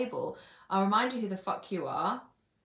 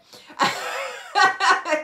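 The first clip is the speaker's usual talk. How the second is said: laugh; 12 semitones higher, 12 dB louder than usual. Real, not acted. Laughs after retelling client banter.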